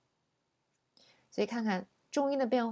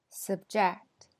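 subject stressed on JECT; verb stress. Shown as a demonstration. Teacher repeats the verb, stress on JECT. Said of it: "后边是动词".

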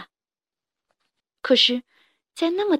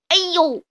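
loud high cry of pain; pained ouch; 6 semitones higher, 4 dB louder than usual. Acted. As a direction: yelp when bitten. T-rex bitten.

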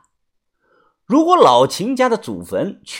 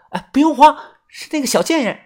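lightly voiced young woman; 12 semitones higher, 4 dB louder, faster than usual. A narrator's reading. Lookout calls back reassuringly.

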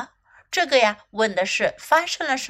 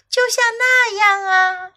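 high, louder called-out line; snake shouting; 10 semitones higher, 5 dB louder than usual. Acted. Snake shouts to little elephant, high calling voice. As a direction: call out high like the snake in the story. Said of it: "蛇喊道".

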